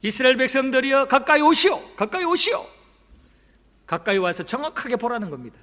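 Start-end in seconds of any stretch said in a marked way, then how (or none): 0.0-2.7 calling out, rising higher on each summons; Elijah. Acted. Elijah calling the people of Israel closer, calling out. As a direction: summon a crowd, raised drawn-up call. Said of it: "가까이 오라고 부릅니다".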